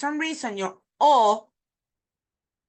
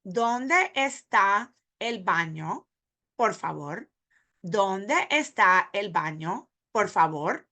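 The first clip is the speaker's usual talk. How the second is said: "¿Dónde está el baño, por favor?" slow, each word apart; slower than usual. Shown as a demonstration. Teacher says the question word by word for beginners. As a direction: space every word out slowly. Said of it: "word by word".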